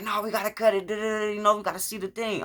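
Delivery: high mock lady's voice; imitated ambulance lady. Retold ambulance lady insisting.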